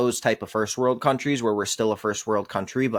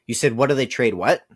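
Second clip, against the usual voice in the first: ordinary delivery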